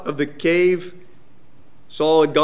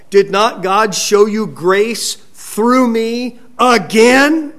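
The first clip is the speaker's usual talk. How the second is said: slow, raised, loud rhetorical question; declamatory challenge; 5 semitones higher, 5 dB louder, slower than usual. Retold or imitated. David confronts Saul about God's grace, declaiming. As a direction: stretch it out high and loud to the end.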